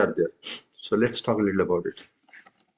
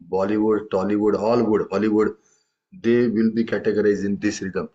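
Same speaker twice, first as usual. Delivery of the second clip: normal talking voice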